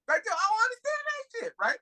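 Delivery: high, disbelieving squeak of a question; can't-believe-it high question; incredulous. Real, not acted. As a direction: pitch it way up in disbelief. Incredulous at the movie line.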